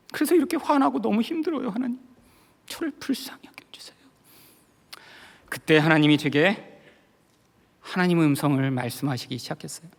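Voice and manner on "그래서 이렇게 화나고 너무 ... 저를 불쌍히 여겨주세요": high plea fading to a whisper; plead, then let the voice fall away to breath; retold pleading prayer. Pastor begging God for mercy, fading plea. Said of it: "그때 기도하기 시작했습니다".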